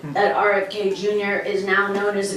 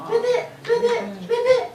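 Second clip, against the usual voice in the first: very high, squeaky little frog; high-pitched puppet frog; Baby Ribbit; 11 semitones higher than usual. Pretend. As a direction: croak ribbit in a tiny high voice. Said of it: "Baby Ribbit".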